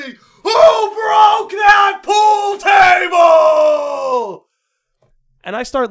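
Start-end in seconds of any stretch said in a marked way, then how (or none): 0.0-4.5 drawn-out, high, full-force mock interrogator scream. Head of security exploding at a silent student, screaming. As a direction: bellow it, stretching every word. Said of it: "just screams at me".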